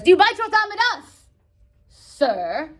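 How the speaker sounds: high, loud challenge; challenging. Acted. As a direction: demand an answer, bristling. Repeated challenge.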